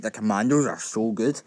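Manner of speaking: ordinary talk, nothing marked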